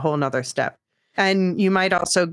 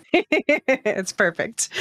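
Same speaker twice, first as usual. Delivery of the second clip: laughing; 6 semitones higher, much slower than usual. Real, not acted. Laughing at the voice impression.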